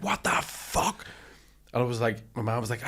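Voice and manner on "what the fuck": retold fuming. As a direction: act out stifled outrage, stretching it slowly. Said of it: "like, fuming".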